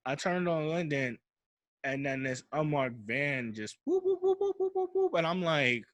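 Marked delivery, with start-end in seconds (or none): 3.9-5.1 high-pitched police siren whoop; quick run of high imitated siren whoops. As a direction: whoop like a squad car siren.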